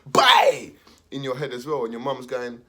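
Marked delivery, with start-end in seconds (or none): 0.1-0.7 loud, higher slap sound; sharp shout. Retold or imitated. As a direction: snap it out loud. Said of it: "the teacher was going".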